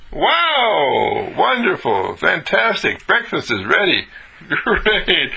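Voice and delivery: high, gushing wow at good news; delighted incredulity. Acted. Told that breakfast is ready, delighted incredulity. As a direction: marvel at it, stretched and high. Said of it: "that's sort of an incredulity too".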